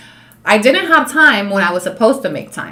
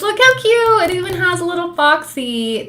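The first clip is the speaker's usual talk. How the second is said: high, delighted gushing over a gift; 10 semitones higher, 4 dB louder than usual. Real, not acted. Thanking Elilo for a cute gift, high and delighted. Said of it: "thanking a gifter".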